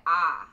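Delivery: "ah" said loud, open and flat, no glide; the Texan "ah" for I, mid-sentence. Shown as a demonstration. Friend showing the Texan I: "ah" open and flat. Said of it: "I'll say".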